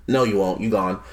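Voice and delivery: low, soft brush-off; quiet mock dismissal; dismissive retort. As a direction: shut him down flatly under the breath. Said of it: "he was kinda just like".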